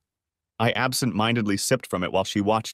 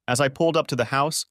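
ordinary delivery

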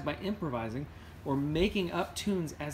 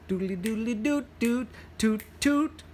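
higher, quick trumpet scat; 7 semitones higher than usual. Acted. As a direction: toot it out like a bright horn. Trumpet sound in story.

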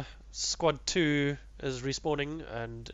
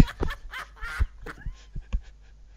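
high shocked laugh. Real, not acted. Shot player laughs in shock.

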